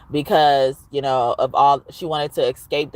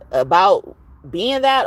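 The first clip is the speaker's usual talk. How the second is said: ordinary talk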